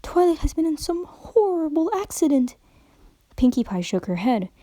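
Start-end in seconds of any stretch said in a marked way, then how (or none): 0.0-2.5 higher, strained polite voice; patient but barely holding it; strained politeness. A narrator's reading. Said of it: "as politely as she could manage".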